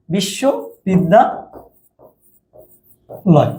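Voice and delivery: বিশ্ববিদ্যালয় very slowly, broken up by long pauses; syllables drawn apart. Shown as a demonstration. Teacher writing out the word to count vowels, slow separated chunks. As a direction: stretch বিশ্ববিদ্যালয় into well-spaced parts.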